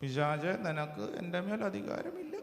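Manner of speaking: very soft, timid mock command; mock-feeble rebuke. Shown as a demonstration. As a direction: say it faintly, with no authority at all. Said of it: "എങ്ങനെ പറയണ്ടേ".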